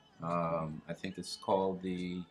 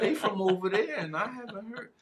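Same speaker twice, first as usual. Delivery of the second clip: excuse-maker voice; 12 semitones higher, 3 dB louder than usual. Retold or imitated. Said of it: "so you can't say".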